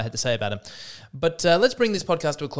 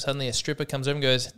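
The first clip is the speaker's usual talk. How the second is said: ordinary delivery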